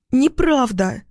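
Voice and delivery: high-pitched protesting cry; shout. A narrator's reading. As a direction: cry out in disbelief. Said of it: "кричит она". (высокий протестующий крик)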